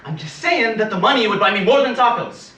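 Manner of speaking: loud arguing. Acted. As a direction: argue the point loudly with a dense victim. Robber arguing with victim.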